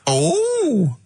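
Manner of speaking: high, hammed-up sketch voice; crazy comedy character. Comic introducing a mock radio character, high and hammed-up. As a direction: announce a silly character in a high put-on voice. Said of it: "You'd have crazy comedy characters".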